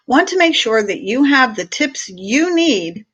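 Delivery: usual speaking voice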